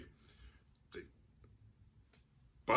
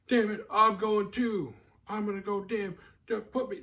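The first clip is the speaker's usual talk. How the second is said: high, loud, slowed whiny cussing; mock teen tantrum; 11 semitones higher, 5 dB louder than usual. The teenage brother cussing at Jody, high and whiny. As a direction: throw a kid's fit about being left out. Fifteen-year-old Mike cussing.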